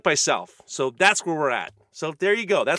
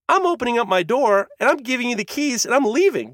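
usual speaking voice

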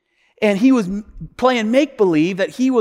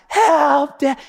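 higher, imitation cry for help; mock child's scream; 8 semitones higher, 4 dB louder than usual. Hurt boy to his father, mock screaming. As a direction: imitate a small boy yelling for rescue. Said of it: "boy screaming for his dad".

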